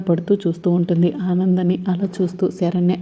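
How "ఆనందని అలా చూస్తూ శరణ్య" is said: usual speaking voice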